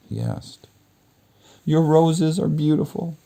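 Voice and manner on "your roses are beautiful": put-on much higher young woman's voice; high girl's voice. Beauty answering the beast in the garden, high girl's voice. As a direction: speak up high as the young heroine.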